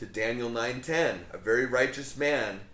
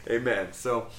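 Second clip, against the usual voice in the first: ordinary delivery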